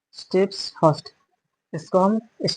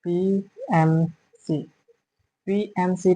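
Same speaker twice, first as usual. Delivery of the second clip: slow dictation; 4 dB quieter, much slower than usual. Shown as a demonstration. Teacher writes abbreviation on board.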